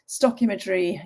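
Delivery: ordinary delivery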